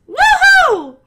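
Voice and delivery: a very high, very loud cheer falling at the end; big woohoo. Acted. Winner cheering to her stream with a very loud, high woohoo. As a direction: scream it like you just won. Celebrating employee of the month win.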